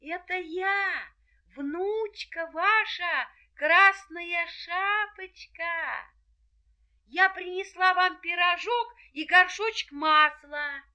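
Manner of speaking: put-on high, slow girlish voice; a sweet little girl at the door. A narrator's reading. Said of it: "и ответила".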